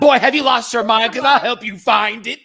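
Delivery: hammed-up loud scolding; mock angry outburst. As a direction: shout it like a fed-up TV character. Acting out Stanley's angry outburst.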